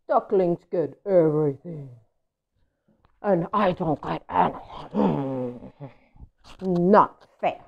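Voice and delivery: lightly voiced, slow grumble; grumpy pigeon. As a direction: sulk through it. Pigeon voice continued.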